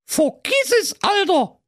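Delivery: very high, cocky little goat voices; all together, mocking; cheeky kids shouting. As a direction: kids calling out in chorus. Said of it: "riefen die Geißlein wie aus einem Munde".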